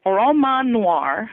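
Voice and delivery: "roman noir" said the French way, slow, rolled open vowels; French pronunciation. Shown as a demonstration. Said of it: "deplorable French accent".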